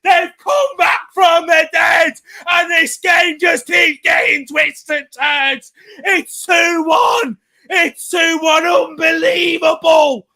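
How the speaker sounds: screaming the goal at full volume. Real, not acted. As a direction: yell it like your team just scored. Live goal reaction shouting commentary.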